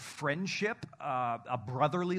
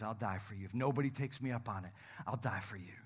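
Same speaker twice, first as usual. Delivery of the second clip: much softer and lower, tender and sincere; tender Jesus; 5 semitones lower, 7 dB quieter than usual. Retold or imitated. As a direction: say it like a quiet promise of love. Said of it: "he says".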